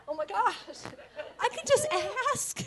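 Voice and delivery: high, laughing amazement; laughing realization. Real, not acted. Realizing she could just ask, laughing. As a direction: giggle at how simple it is.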